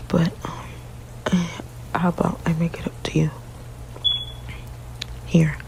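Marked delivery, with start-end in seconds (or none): none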